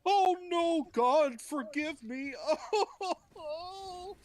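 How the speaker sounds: very high, loud comic begging; hammed-up wail, drawn out and sobbing; mock wailing plea. Cain begging God for forgiveness, mock wail.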